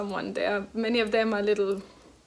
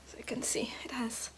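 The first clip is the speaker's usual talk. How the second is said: whispering; 11 dB quieter than usual. Real, not acted. Showing the knitted star.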